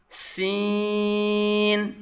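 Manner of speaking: very long, level سین with a whistle-like s; whistling sharp S. Shown as a demonstration. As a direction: hiss into سین and hold the vowel steady. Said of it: "سیٹی کی طرح تیز آواز نکلے گی".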